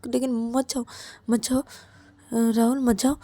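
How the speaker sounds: stammering, repetitive begging; desperate pleading. Acted. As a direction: plead anxiously, tripping over every phrase. Ravi begs Rahul to stay. (घबराई, हकलाती हुई मिन्नतें)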